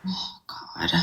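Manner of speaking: breathy whispered dismay. Real, not acted. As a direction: whisper it in disbelief. Reacts to slurs.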